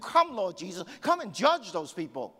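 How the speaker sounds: high, loud, relentless sermon; impassioned preacher at full force; fired-up preaching. Acted. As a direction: hammer it home with mounting passion.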